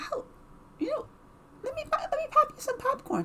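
high, fast eager offer; imitating an eager boyfriend, high and quick. Voicing boyfriend offering popcorn.

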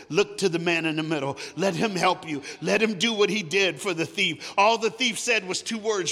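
preaching at full force. Acted. Preacher urging the church to look to Jesus, full force. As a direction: press it home to the congregation.

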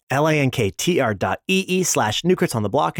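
usual speaking voice

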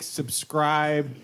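ordinary talk

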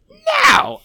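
squeaky high shouted no; hammed-up high yelp of no; Mulaney impression. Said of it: "that's my Mulaney impression".

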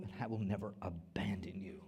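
soft unvoiced whisper; hushed scripture whisper; whispered promise. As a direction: breathe God's promise.

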